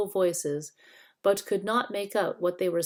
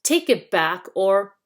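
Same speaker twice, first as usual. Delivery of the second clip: threatening; 9 dB louder than usual. Acted. Threat in a quarrel.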